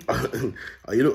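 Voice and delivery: ordinary talk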